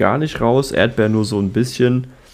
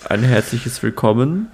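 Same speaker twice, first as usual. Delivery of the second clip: usual speaking voice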